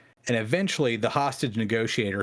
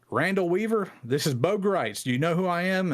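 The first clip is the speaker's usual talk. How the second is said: higher, called up to the house; calling out; 6 semitones higher than usual. Retold or imitated. Bo Greitz calls up to Randy Weaver from the rock, raised and higher. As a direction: shout it up to a window.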